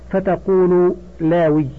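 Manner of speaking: ordinary delivery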